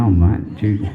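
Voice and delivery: deep, loud broad-Aussie voice; Australian God. Acted. Australian God asking a new soul, deep booming voice. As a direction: ask it low and booming, like a big bloke. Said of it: "An Australian god".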